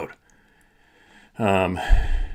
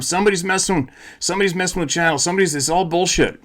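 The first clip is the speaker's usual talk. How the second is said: mocking alarm; 7 semitones higher, faster than usual.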